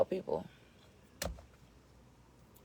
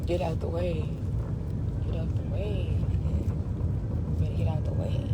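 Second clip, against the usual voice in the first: commanding; 8 dB louder than usual. Real, not acted. Repeated commands to move aside.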